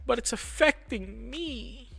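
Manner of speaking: high, slow, whiny mock cry; imitating her crying. Quoting his girlfriend crying, high and slow. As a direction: drag it out like she's sobbing. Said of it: "she was bawling and crying".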